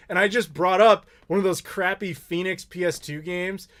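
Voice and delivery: ordinary delivery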